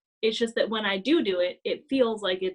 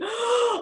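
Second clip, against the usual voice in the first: gasp; 13 semitones higher than usual. Retold or imitated. Gasp before apology.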